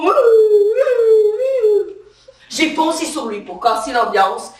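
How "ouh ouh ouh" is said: high drawn-out whoops like a rowdy bar crowd; party whooping. Retold or imitated. Cop mimics the vodka party crowd, high drawn-out whoops.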